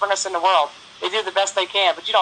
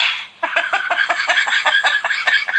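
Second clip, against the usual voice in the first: a high breathy laugh, mostly air; airy laugh; 9 semitones higher than usual. Real, not acted. Host cracking up over the McDonald's story, airy high laugh. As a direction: let a laugh slip out on the breath.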